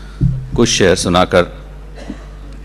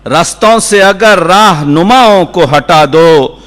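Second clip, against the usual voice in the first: higher, ringing mushaira declamation; poetry recitation; 9 semitones higher, 8 dB louder, slower than usual. Acted. Poet repeating couplets as the audience calls wah, declaiming. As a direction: project each line of verse in a sustained cadence.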